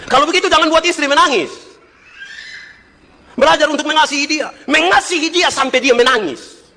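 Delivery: high, sustained preacher's shout; impassioned preaching. Acted. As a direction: cry out the exhortation to husbands. Preaches at full force.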